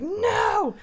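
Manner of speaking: high, loud drawn-out wail of protest; hammed-up defiant yell; emotional refusal. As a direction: act out an overwrought drunken refusal. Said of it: "I got really emotional".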